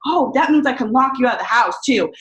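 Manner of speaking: high, loud sarcastic outburst; furious sarcastic shout at husband; going off. Retold or imitated. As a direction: throw his behaviour back at him, loud. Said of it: "going off".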